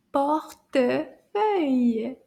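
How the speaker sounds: "Portefeuille" very drawn out, syllables apart; slow take. Shown as a demonstration. Teacher pointing out the E sound, slow separated syllables. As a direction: stretch "Portefeuille" slowly, pausing between syllables. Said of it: "Let's say it a little bit faster".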